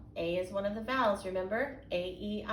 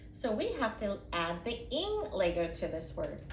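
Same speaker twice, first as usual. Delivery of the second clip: ordinary talk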